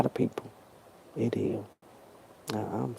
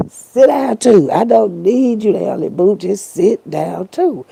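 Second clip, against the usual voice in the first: loud, raised-pitch imitation of God giving orders; God's firm command; 6 semitones higher, 7 dB louder than usual. God telling a would-be helper to sit, firm loud command. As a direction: play God insistently telling someone to sit. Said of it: "And God said".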